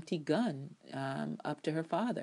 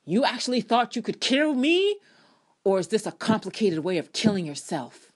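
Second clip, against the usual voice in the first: louder, higher, taunting delivery; menacing villain quote; 5 semitones higher, 8 dB louder than usual. Retold or imitated. Podcaster voicing Rowan taunting his enemy, louder mock menace. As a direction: sneer it like a smug villain.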